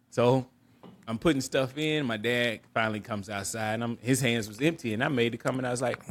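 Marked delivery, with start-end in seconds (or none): none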